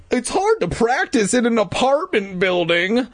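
usual speaking voice

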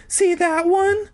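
put-on very high, slow girly voice; high woman voice. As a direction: play Carolyn high and sweet. Carolyn's high voice.

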